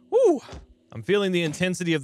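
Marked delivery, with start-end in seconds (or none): 0.1-0.4 excited whoop. Real, not acted.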